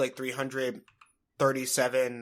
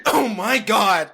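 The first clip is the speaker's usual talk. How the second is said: laughing; 5 semitones higher, 6 dB louder, much slower than usual. Real, not acted. Cracking up.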